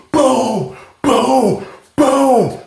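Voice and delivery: high, falling booms, one at a time; imitated door kicks. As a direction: mimic repeated kicks on a cell door. Imitates door being kicked.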